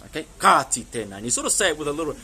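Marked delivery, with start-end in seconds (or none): 0.4-1.1 firm, louder telling-off; a touch of anger, said loudly; slightly angry. Shown as a demonstration. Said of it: "that sort of angry".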